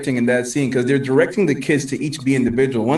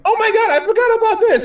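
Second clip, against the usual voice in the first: very high, thrilled squeal; imitated amazed viewer; 4 dB louder than usual. Acting out a viewer seeing Pepper's suit, high excited exclamation. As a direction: pitch it way up in mock astonishment. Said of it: "you're like".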